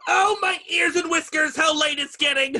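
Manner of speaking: high, loud, frantic rabbit cry. Acted. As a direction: shriek it like a rabbit running very late. Rabbit panics about being late.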